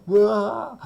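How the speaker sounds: sudden loud yelp of surprise; startled yelp. Real, not acted. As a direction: jump at what you see. Startled by camera setup.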